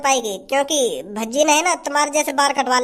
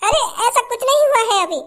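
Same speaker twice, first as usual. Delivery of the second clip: higher, fast, wounded bride voice; hurt new bride; 6 semitones higher, faster than usual. Acted. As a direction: play the hurt young bride, faster. Bride reassures husband. (नई दुल्हन की आहत, ऊँची, तेज़ आवाज़)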